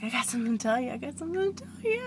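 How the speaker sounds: giddy retold announcement, rising at the end; imitated excitement. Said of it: "and he's so excited".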